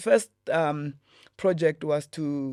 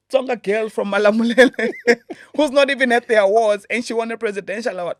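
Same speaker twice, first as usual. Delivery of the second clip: laughing; 7 semitones higher, 10 dB louder than usual. Real, not acted. Laughing at own surprise.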